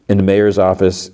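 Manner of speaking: ordinary talk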